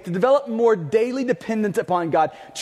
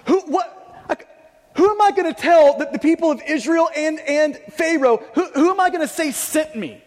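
Moses objecting; 6 semitones higher than usual. Retold or imitated. Moses protesting to God who sent him, higher and flustered. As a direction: sputter out the objection.

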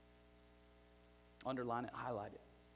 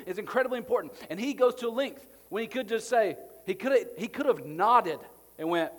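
loud, driving sermon delivery; preaching at full force; 8 semitones higher, 12 dB louder than usual. Acted. Pastor to the church about the cross, loud fervent preaching. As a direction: hammer it home to the congregation.

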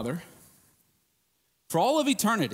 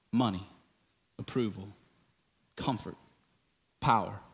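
low spaced list; 12 semitones lower, 6 dB quieter than usual. Acted. Listing idols, low and spaced.

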